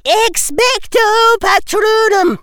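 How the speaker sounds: high, slow, commanding cast; spell incantation. Acted. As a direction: call the spell out. McGonagall casts spell.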